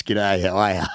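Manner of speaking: put-on Australian accent. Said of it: "Marcus from Australia".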